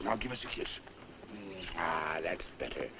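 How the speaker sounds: a tender request for a kiss; coaxing. Acted. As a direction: sweet-talk her into a kiss. Coaxing her gently.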